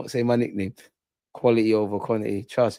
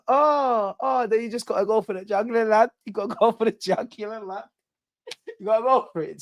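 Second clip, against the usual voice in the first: hyped-up urging; 9 semitones higher than usual. Pretend. Hyped voice, far timbre.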